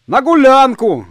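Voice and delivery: high, loud call back; ringing distant-reply shout; shouted answer. A narrator's reading. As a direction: holler back from the road. Passers-by shout back from the road.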